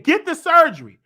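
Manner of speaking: high, loud command; emphatic. Retold or imitated. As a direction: bark the advice. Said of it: "I said".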